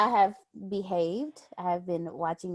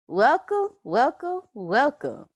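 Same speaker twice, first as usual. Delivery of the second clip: louder and higher, sing-song run of welcomes; extra welcomes; 6 semitones higher, 5 dB louder, slower than usual. Real, not acted. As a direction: pile on welcome after welcome, gleefully. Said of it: "putting the extra welcomes in there".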